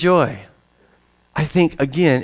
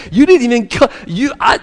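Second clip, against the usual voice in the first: usual speaking voice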